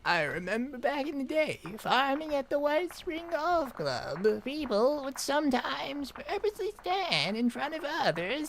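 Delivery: put-on high old lady voice. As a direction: tell it like an old grandma reminiscing. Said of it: "Old lady voice".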